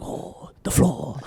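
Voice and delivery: slow, soft whispered woman's voice; girlfriend impression. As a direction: whisper it slowly like a put-on woman. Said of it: "I'm working on my accents".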